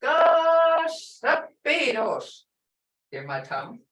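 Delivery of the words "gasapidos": GASAPIDOS with a long, high, held first syllable; heavy emphasis. Shown as a demonstration. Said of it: "really want to put emphasis".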